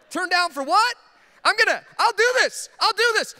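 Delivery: high, loud and laughing, raring to go; sudden laughing burst of eagerness; eager excited yell. Retold or imitated. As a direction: leap at the chance, laughing. Excited reaction to wife's remark.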